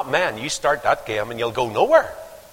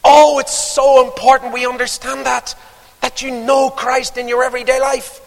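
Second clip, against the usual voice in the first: high, loud and impassioned; fervent preaching; 10 semitones higher, 9 dB louder than usual. Acted. Preacher crying out to his congregation, fervent.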